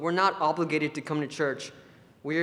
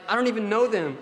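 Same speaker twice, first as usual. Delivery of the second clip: surprised protest; 6 semitones higher, 4 dB louder than usual. Retold or imitated. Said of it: "And Kevin's like".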